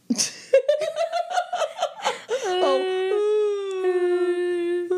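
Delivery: loud high laugh breaking into tears; laugh-cry. Real, not acted. Laughing till she cries about sad shows. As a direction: laugh so hard it turns to crying.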